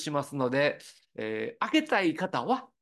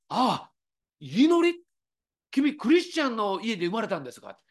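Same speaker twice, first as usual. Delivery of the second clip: high, surprised echo of the name; surprised conductor; 6 semitones higher than usual. Retold or imitated. Conductor Ozawa, hearing the violinist's name, higher in surprise. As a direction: repeat the name in astonishment.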